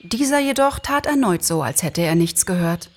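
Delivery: ordinary delivery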